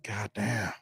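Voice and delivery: "God damn" dropped low and soft, drawn out. Shown as a demonstration. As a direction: say "God damn" deep and hushed, awed. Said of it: "you gotta say it like".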